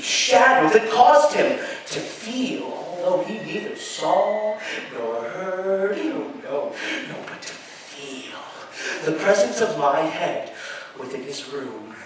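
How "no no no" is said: plain reading voice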